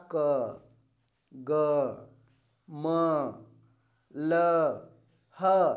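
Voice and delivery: slow, separate letters with long pauses between; teacher sounding out consonants for the class; letters one by one. Shown as a demonstration. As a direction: sound out क, ग, म, ल, ह slowly, one at a time. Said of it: "जैसे शब्द हमने बोला".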